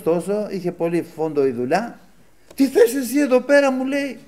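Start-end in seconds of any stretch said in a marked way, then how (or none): 2.5-4.2 very high, loud mock yelling; re-enacted irritated outburst, pitched right up; retold angry shouting. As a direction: imitate a stressed-out worker snapping at you. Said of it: "και φώναζε".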